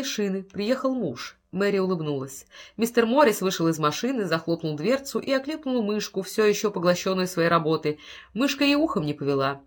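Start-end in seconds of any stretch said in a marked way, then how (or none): none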